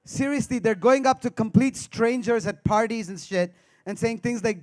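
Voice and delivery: ordinary delivery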